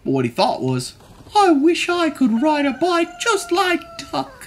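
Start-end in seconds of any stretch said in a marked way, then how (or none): 1.4-4.3 high, small mouse voice; wistful mouse. A narrator's reading. Mouse envying Duck's bike, high wistful voice. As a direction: wish aloud in a high mouse voice.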